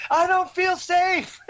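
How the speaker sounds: very high, drawn-out mock wail; imitated panicked yell. Woman sprinting down the street, yelling back, mock panic. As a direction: mimic someone yelling slowly over her shoulder. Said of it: "over her shoulder yelling".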